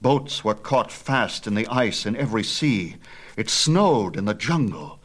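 plain reading voice

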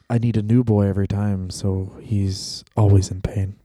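low mock tycoon; Vince McMahon voice. Both imitating Vince.

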